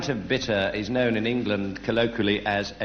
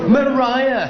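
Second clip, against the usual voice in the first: exclaimed; 12 semitones higher, 9 dB louder than usual. Acted. Comic poem, name sung out.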